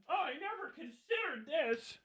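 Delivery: very soft, high-pitched wonder; mock epiphany. Soldier supposedly swayed by a film, soft high mock wonder. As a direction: breathe out a tiny mock revelation. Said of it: "And I wasn't like".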